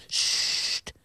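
long unvoiced "sst"; hissed shush. A narrator's reading. Old man silencing Jonathan's question, hissed shush. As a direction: hush the boy with a sharp sibilant hiss. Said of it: "siste de oude man".

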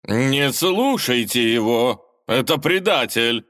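slow, loud barked order; barking. A narrator's reading. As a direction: bark it at the crowd. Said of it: "рявкнул лже-Иван".

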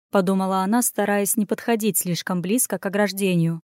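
usual speaking voice

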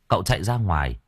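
usual speaking voice

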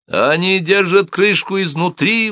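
slow, high-pitched outcry; exclaiming. A narrator's reading. Cobbler alerting soldiers at the stuck hatch, exclaiming. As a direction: exclaim it high, stretching the words. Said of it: "воскликнул Симон".

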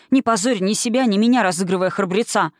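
ordinary delivery, nothing marked

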